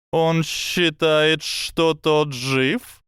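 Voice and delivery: high, slow serpent voice; a hissing snake, slow and sly. Acted.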